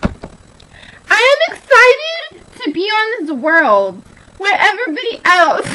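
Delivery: loud, slow, stretched-out declaration; mock excitement. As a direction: proclaim it with hammed-up excitement. Acting out excitement.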